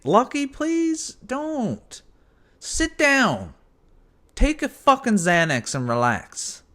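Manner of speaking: high, slow coaxing to the cat; pleading with the cat. Real, not acted. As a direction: draw it out high to stop the cat. Telling cat to stop.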